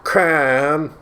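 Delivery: crème drawn out, pretentious "krem"; posh French crème. Shown as a demonstration. Mocking the coffee menu, pretentious French crème. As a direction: say "crème" like a snob ordering. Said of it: "crème is the French for cream".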